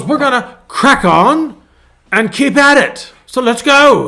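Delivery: high, loud, hammed-up British imitation; rally the crew in a put-on British accent; mock British accent. Pastor imitating a renovator getting back to work, mock British accent. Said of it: "You gotta say it right".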